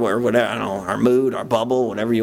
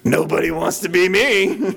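mock grump, laughing; 6 semitones higher than usual. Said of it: "walking around the store like".

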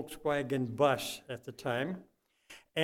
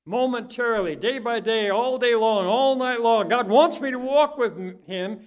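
rising, louder, preaching fervor; building preaching shout; 7 semitones higher, 8 dB louder than usual. Real, not acted. Preacher pressing the congregation, building to a loud cry. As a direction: let it swell into a fervent cry.

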